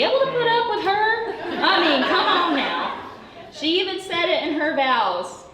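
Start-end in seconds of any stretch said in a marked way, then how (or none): 0.0-3.1 high, laughing through the words. Real, not acted. Maid of honour laughing about the groom putting up with the bride. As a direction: laugh as you tease the groom.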